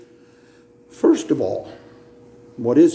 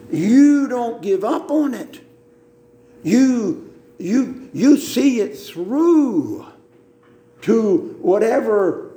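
higher, rising preacher's push, slowing on "see it through"; fervent urging; 7 semitones higher, slower than usual. Real, not acted. Preacher urging the church not to give up, fervent. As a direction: preach it home, don't let them quit.

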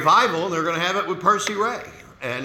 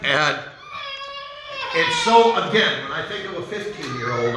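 choked-up outburst; 5 semitones higher than usual. Real, not acted. Preacher overcome with emotion.

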